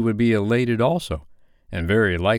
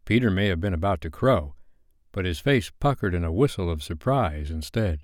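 plain reading voice

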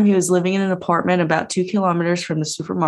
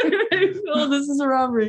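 high holdup echo through laughter; playful imitation of a cartoon robber; mock robber; 8 semitones higher, much faster than usual. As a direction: giggle through a stick-up line. Co-host echoes the robber voice.

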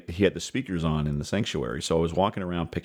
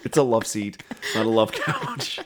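higher, laughing mock protest; mock exasperated correction; 5 semitones higher, 3 dB louder than usual. Dad retelling how he corrected his child, amused protest. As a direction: correct them in playful protest, breaking into laughter.

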